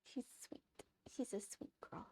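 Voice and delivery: soft whisper about the dog. Real, not acted. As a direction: hush it so the dog stays calm.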